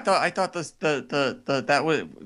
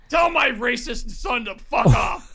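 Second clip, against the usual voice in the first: wrestler voice; 6 semitones higher, 5 dB louder, slower than usual. Acted. Hogan voice caller.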